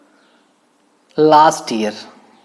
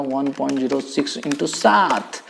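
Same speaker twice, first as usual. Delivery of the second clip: ordinary delivery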